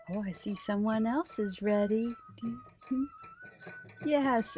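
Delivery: ordinary delivery, nothing marked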